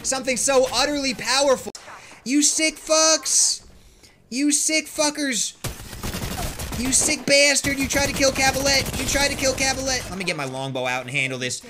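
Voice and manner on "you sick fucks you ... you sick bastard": mock outrage.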